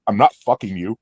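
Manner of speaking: lightly voiced low, deadpan refusal. Singer turning down a fan's beer offer, low retold deadpan. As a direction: drop the pitch and turn them down. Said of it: "and he goes".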